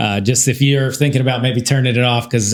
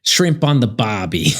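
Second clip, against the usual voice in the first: put-on Australian accent; 6 semitones lower than usual. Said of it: "Australian accent".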